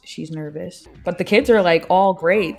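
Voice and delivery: ordinary delivery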